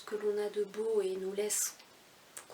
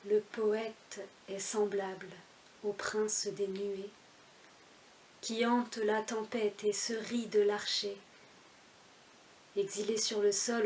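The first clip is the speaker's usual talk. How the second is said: louder, measured verse reading with deliberate pauses; poem recitation; 7 dB louder than usual. Acted. Reciting Baudelaire's L'Albatros, as a measured poem recitation. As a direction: declaim the verse line by line, each phrase set apart.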